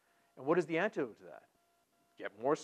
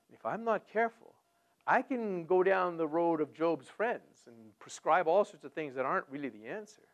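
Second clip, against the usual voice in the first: ordinary talk, nothing marked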